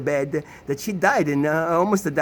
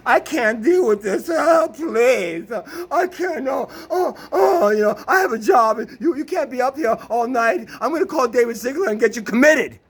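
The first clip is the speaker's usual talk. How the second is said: higher, louder, whiny fake-crying voice; mock distraught girlfriend; 5 semitones higher, 3 dB louder than usual. Ex-girlfriend throws him out of the house, mock sobbing and whining. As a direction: ham up her tearful panic.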